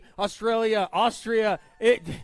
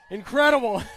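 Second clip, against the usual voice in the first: loud, laughing burst of delight; excited shout; 2 semitones higher, 6 dB louder than usual. Real, not acted. Greeting a packed crowd, laughing and shouting with delight. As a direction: belt it out with a laugh, thrilled.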